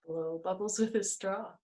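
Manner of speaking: usual speaking voice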